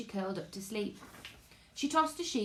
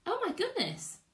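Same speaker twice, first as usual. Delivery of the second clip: surprised; 12 semitones higher than usual. Real, not acted. Sees author has joined stream.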